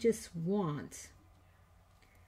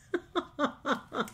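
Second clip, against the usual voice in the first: nervous giggle; 7 semitones higher than usual. Real, not acted. Laugh during tense moment.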